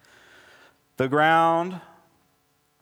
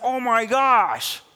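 high, drawn-out mock disbelief; incredulous, pitched well up, stretching the words; exasperated Jesus; 7 semitones higher, 3 dB louder, slower than usual. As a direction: act out a teacher at the end of his patience. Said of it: "He's, like, got the nervous twitch".

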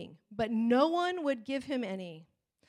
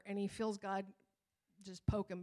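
normal talking voice

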